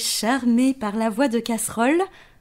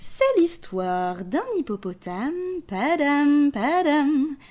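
put-on reading to children; 4 semitones higher, much slower than usual. A narrator's reading. Reads story to child.

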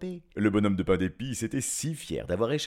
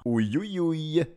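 squealing in fright; 6 semitones higher, 4 dB louder, slower than usual. Acted. Gingerbread man being eaten.

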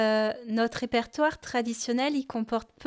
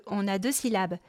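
normal talking voice

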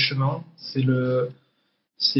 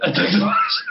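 laughing; 10 semitones higher than usual. Real, not acted. Echoing partner's phrase, laughing.

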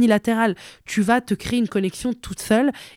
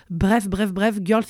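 normal talking voice

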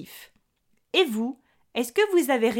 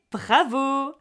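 cheerful bravo; 3 semitones higher than usual. Acted. Congratulating listeners on the answer.

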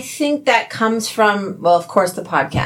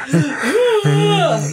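mock gasp; 10 semitones higher than usual. Mock startled reaction.

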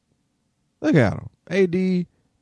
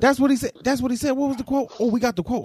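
excited; 12 semitones higher, faster than usual. Real, not acted. Excited hunt for the quote.

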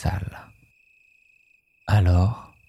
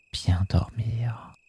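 slow whispered bien dormir; soft spa whisper; 3 semitones higher, 5 dB quieter, slower than usual. Shown as a demonstration. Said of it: "echo of bien dormir".

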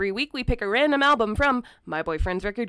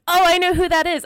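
excited; 9 semitones higher, 8 dB louder than usual. Retold or imitated. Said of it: "I'm like".

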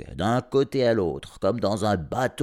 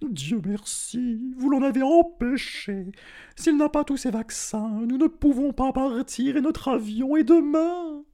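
voix de fausset très haute, posée; voix de dame aiguë; 4 dB louder than usual. A narrator's reading. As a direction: une dame inquiète, voix perchée. La dame parle au vétérinaire. (la dame au vétérinaire, voix très aiguë)

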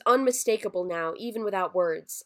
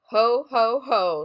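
jolly Santa laugh; 4 semitones higher, 8 dB louder, slower than usual. Acted. Claus laughs.

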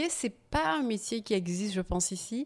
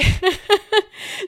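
high, loud real laugh; burst of laughter; 14 semitones higher, 13 dB louder than usual. Real, not acted. Reacting to the interviewer's stunned face, real loud laughter. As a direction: crack up at the listener's face.

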